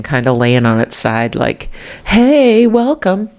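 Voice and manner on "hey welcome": skeleton greeting. Pretend. Voicing the skeleton on the doormat, playful cartoon greeting. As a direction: give the mat's skeleton a cheery cartoon voice.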